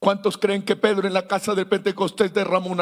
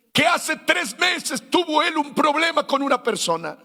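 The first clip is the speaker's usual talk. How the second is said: preaching at full force; 5 semitones higher than usual. Acted. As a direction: hammer the testimony home to the crowd.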